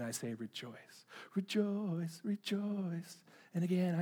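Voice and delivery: hushed, repeated verse like a quiet prayer; soft scripture recitation. Acted. Preacher softly repeating scripture to the congregation, hushed. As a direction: let the verse fall softly, over and over.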